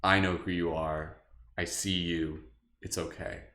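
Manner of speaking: soft, low and comforting; gentle reassurance. Retold or imitated. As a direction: soothe a hurt friend quietly. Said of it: "just be like".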